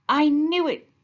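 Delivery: high, loud storybook scream; loud and high, mock alarm. A narrator's reading. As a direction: shriek it out as the alligator. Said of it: "screamed Alligator".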